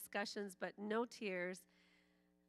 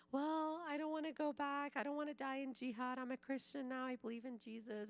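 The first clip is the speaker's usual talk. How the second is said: put-on young brother pleading, voice pitched higher; pleading boy; 7 semitones higher than usual. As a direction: beg like a scared kid standing his ground. Brother pleading with angry father.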